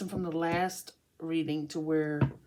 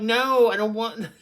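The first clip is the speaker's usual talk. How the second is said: mock refusal; 3 semitones higher, 7 dB louder than usual. Acting Scorpio refusing someone.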